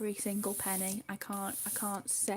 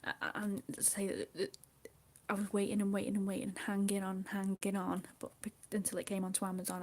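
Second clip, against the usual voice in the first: usual speaking voice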